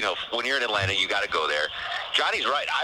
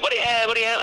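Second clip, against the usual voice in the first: high, loud, rapid-fire server's call; pitched-up mock shout, repeated; retold counter shout; 10 semitones higher, 4 dB louder, faster than usual. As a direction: imitate an impatient order-taker calling out. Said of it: "these people are shouting at me".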